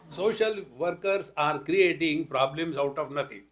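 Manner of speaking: ordinary delivery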